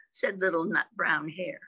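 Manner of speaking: plain reading voice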